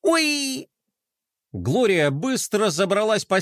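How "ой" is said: high-pitched squeak of surprise; startled yelp. A narrator's reading. Girl crashing into a box, yelping. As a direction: squeak on sudden impact.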